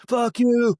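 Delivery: high, loud mock jeer; heckler's shout. Heckler answering the pleading comic, loud mock shout. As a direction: hurl it like an angry audience member.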